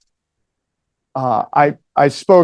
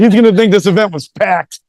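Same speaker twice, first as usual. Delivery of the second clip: mock-impressed with himself, punched up; amused boast; 3 semitones higher, 5 dB louder than usual. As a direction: exclaim it as a retold thought. Gleeful reaction acted in a story.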